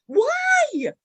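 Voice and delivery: high cry of why; anguished why. Retold or imitated. Angry child yelling at her dead father, high and loud.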